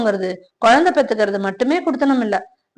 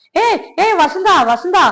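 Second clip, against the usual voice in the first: calling out; 7 semitones higher, slower than usual. Acted. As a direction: call her name to stop her walking off. Saravanan calls after departing Vasantha.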